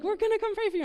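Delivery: high-pitched imitation of eager helpers; eager church women. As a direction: chirp it like keen prayer-group ladies. Imitating eager women offering prayer.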